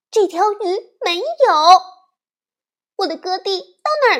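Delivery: put-on high, fast, anxious child's voice; a little sister bear alarmed about her goldfish; little girl bear. 小熊妹妹发现金鱼不对，急切高声.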